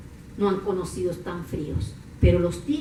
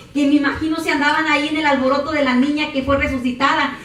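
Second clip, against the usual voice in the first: ordinary talk, nothing marked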